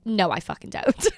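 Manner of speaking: ordinary talk, nothing marked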